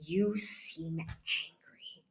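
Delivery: very slow, soft, rising at the end; tentative guess with no accusation; calm questioning tone. Shown as a demonstration. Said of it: "Again, I am calm".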